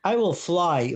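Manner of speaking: normal talking voice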